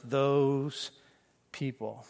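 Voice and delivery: those people said low and scornful; fading disdainful mutter. Acted. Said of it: "when you say to yourself".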